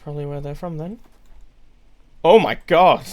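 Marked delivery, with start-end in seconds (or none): 2.2-3.0 startled shout. Real, not acted. Startled by sudden enemy.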